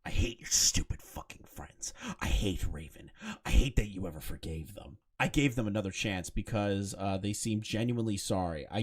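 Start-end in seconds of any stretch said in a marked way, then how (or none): 0.0-3.1 hushed unvoiced read of an angry message; whisper. Reading his texts aloud in a whisper. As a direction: whisper the insult like a secret.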